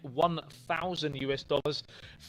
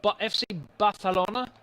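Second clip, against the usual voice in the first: put-on Spanish accent, a bit higher; 6 semitones higher, 5 dB louder than usual. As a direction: say the club name with a Spanish accent. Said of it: "Did you like my Spanish accent there?".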